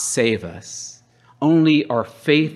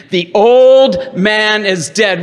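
loud, raised, drawn-out chant; rally the congregation to say it with you; slow, forceful preacher's call; rallying repeat; 7 semitones higher, 7 dB louder, slower than usual. Shown as a demonstration. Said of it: "Say it again".

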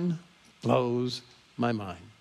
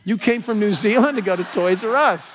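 higher, louder incredulity; amused disbelief; 6 semitones higher, 6 dB louder than usual. Retold or imitated. Traveler reacting to Kiwis visiting a toy store, incredulous. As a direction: marvel at the absurdity. Amazed at their reason for travel.

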